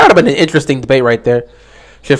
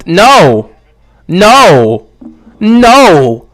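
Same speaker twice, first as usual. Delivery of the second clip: louder flat refusals, one after another; emphatic no; 2 semitones higher, 5 dB louder, much slower than usual. Real, not acted. Host rejecting a chat question, loud repeated refusals. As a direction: shut the idea down firmly, louder each time. Rejecting chat's Watson idea.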